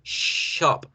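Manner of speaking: "shop" begun with a silencing sh; long hush into "shop"; thick SH. Shown as a demonstration. Teacher links the sound to silence, long SH. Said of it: "El silencio".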